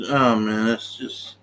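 ordinary delivery

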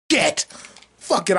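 normal talking voice

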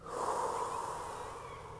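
long soft breath out through the mouth; deep breath with listeners. Real, not acted. Breathing along with her listeners, a long calming breath. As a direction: breathe out slowly, letting tension go. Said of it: "let's take another one".

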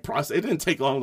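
ordinary delivery

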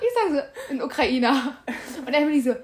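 words spilling through real laughter; giggling as you retell the funny bit; laughing while talking. Real, not acted. Friend laughing retelling story.

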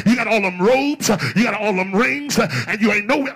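loud indignant accusation, building phrase by phrase; Martha confronting Jesus. Acted. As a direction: go toe to toe, hammering out each charge. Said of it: "she's telling him toe to toe".

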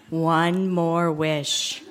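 slow drawn-out eerie voice; creepy voice. Acted. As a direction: stretch it out like a spooky witch. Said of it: "in a creepy voice".

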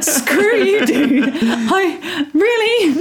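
high, laughing indignation; amused, offended squeal of objection; laughing protest. Real, not acted. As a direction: protest through real laughter at being teased. Teased about her ugly cry.

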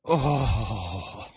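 ordinary talk, nothing marked

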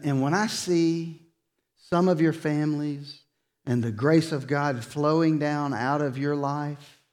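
ordinary talk

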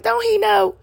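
can't believe what you're hearing; mother can't believe a Mexican boy said it, in high disbelief; incredulous. Retold or imitated. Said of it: "I'm like".

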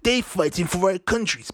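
pompous speech mimicry, table-banging; mocking Boris speech. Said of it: "I see man banging on the table".

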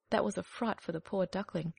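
ordinary delivery

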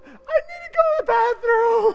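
put-on high, giggly little-girl voice pitched well up. Pastor imitates the little girl asking for the bathroom, high and giggly. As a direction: squeak it like a child laughing on the floor. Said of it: "she goes".

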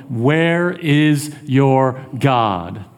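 drawn-out, word-by-word taunt; slow, deliberate scriptural jeer. Acted. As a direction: stretch each word of the taunt. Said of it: "my adversaries taunt me".

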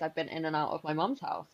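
ordinary talk, nothing marked

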